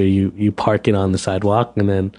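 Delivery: usual speaking voice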